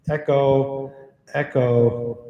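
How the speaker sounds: word ringing out with an echo tail; mic echo switched on, trailing repeats; echo effect. Shown as a demonstration. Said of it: "You can see what the echo sounds like".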